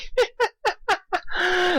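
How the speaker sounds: high squeaky giggle; a giddy high cackle. A narrator's reading. Pinkie giggling at Dash, squeaky high laugh.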